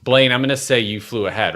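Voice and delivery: ordinary talk, nothing marked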